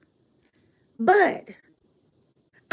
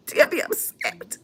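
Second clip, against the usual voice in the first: breathy whispered aside through laughter; laughing whisper; 7 dB quieter, slower than usual. Real, not acted. Laughing aside about Tia.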